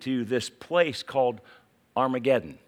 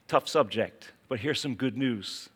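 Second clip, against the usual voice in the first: ordinary talk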